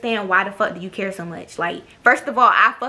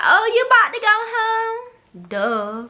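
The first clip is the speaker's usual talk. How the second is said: much higher, slow, drawn-out imitated question; mocking the roommate; 12 semitones higher, much slower than usual. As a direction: parody her fake-sweet tone. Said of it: "So she like".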